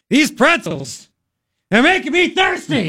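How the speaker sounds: slow, strained, rising to a high cry; George's angry take. Retold or imitated. Host imitating George's angry hammed-up take, slow and high. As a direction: overact the line in frustration. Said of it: "George got angry with it".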